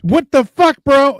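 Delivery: high, loud disbelieving shout; incredulous yell. Retold or imitated. Crowd yells at the calm handler, loud disbelief. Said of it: "And you're like".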